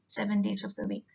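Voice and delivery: ordinary talk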